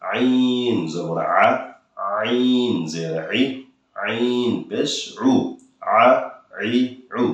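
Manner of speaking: আইন জবর আ drawn out very slowly; slow and pressed. Shown as a demonstration. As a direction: take your time, press the throat. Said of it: "তাড়াহুড়ো করবেন না". (ধীরে, চাপ দিয়ে আইন)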